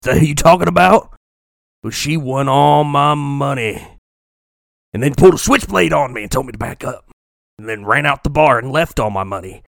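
mock-menacing gambler, deliberate; scary gambler. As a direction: drag the words out like a barroom heavy. Voices big scary gambler.

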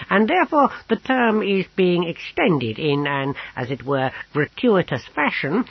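high-pitched, long-winded qualifying; rambling fussy apothecary. A narrator's reading. Apothecary backtracks on offering his house, floundering. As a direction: struggle on toward the sentence's end, fussily. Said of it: "having some trouble getting to the end".

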